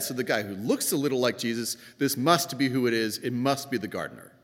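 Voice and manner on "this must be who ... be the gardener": usual speaking voice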